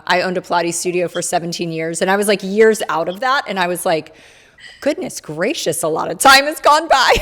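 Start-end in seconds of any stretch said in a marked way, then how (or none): 4.8-7.1 retold amazement, rising higher and louder; amused astonishment. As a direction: marvel playfully, building towards a laugh. Retells own astonished reaction, then laughs.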